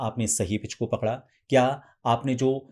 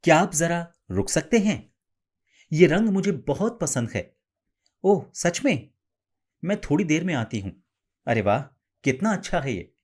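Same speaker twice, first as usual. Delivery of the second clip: higher, bright-faced sample lines said with a smile; smiling feminine high tone; 5 semitones higher than usual. Shown as a demonstration. Voice coach demonstrating practice lines with a smile, high and expressive. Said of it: "smile के साथ और expression के साथ".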